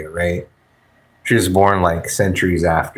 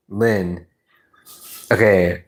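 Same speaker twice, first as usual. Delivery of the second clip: usual speaking voice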